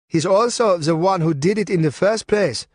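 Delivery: ordinary delivery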